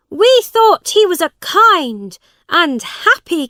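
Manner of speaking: high, loud complaint; crowd calling out. A narrator's reading. People in the crowd grumble about their king, calling out. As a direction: raise your voice in disappointment.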